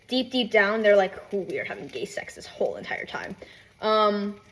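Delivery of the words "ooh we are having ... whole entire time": low-key whispered confession; secretive mock whisper. Rivals' hidden feelings under the hate, secretive whisper.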